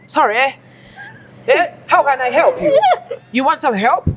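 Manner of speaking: fake American accent. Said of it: "talking to their American counterparts".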